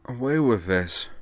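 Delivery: slow, slurred unsteady voice. Acted. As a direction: drag the words out, woozy. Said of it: "said a blurry voice".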